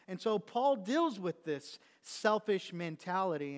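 loud rising preacher's cadence; preaching. Acted. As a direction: drive the point home from the pulpit. Preaching at full force.

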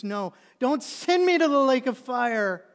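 high, drawn-out shouted plea; desperate, stretched-out cry for mercy; terrified begging. Acted. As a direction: wail it as if being dragged away. Acted condemned sinner begging Jesus.

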